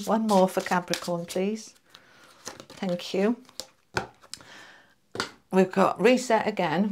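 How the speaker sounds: normal talking voice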